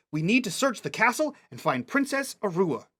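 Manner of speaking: earnest little commander voice; child commander. Acted. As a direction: give orders as a girl playing captain. Child commands her pretend soldiers.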